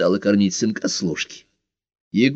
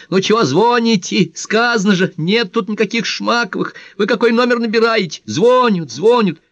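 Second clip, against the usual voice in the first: high-pitched shrewish yell; loud, shrill-pitched nagging; quarrelsome shouting; 14 semitones higher, 4 dB louder than usual. A narrator's reading. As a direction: yell crossly down the phone at a caller. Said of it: "сварливо закричала".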